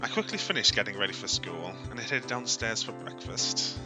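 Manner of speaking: ordinary talk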